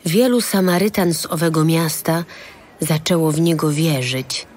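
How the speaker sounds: usual speaking voice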